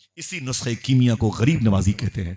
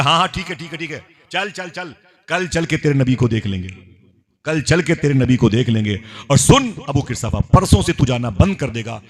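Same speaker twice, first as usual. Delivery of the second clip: high, brusque giving-in; acted exasperated aunt, pitched up; impatient aunt; 5 semitones higher than usual. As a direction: brush him off, then warn him. Aunt impatiently agrees, then warns.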